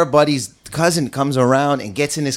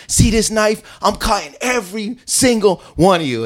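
higher put-on voice, mock menace; menacing thug; 8 semitones higher than usual. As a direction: flash the knife and threaten, hammed up. Knife guy shows knife.

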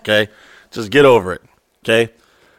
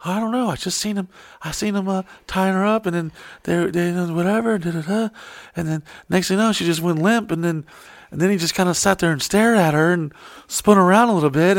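higher and softer retelling; soft scared witness; 6 semitones higher, 5 dB quieter than usual. Retold or imitated. Retelling what the girl said in a soft, higher voice. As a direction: lightly voice a shaken girl, hushed. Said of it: "She's like".

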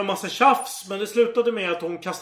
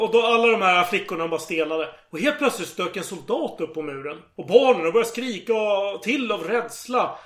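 ordinary talk

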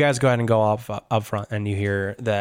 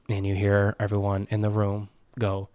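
ordinary talk

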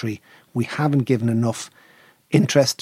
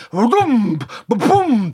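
loud, high booming drum beats; loud mock drum booms; drum imitation; 8 semitones higher, 4 dB louder, much slower than usual. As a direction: pound out the drum.

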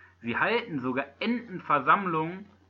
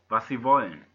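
ordinary delivery

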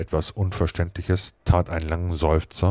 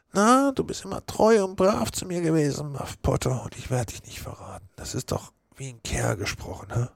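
higher, then fading sly promise; false reassurance; 10 semitones higher than usual. Acted. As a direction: soothe him with a hollow promise.

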